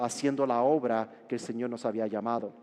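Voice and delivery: ordinary talk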